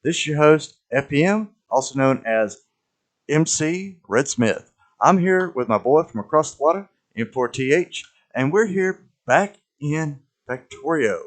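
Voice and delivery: booming radio host greeting; big announcer voice, high and loud; crackly lonely radio dj. Acted. Fallout-style radio host intro.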